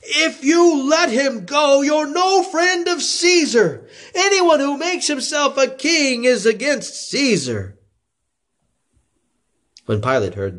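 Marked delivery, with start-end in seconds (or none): 0.0-7.8 high, slow, forceful yell; crowd shouting. A narrator's reading.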